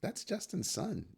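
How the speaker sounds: slow, high knowing drawl; knowing remark. Retold or imitated. Vlogger judging Justin Sun, slow high knowing remark. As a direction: roll your eyes at him. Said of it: "I'm just going".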